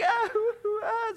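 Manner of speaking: high, loud mock screams mixed with a whoop; scared child half joining the cheer; imitated shrieky, reluctant woohoo; imitated wailing whoop. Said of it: "she's like".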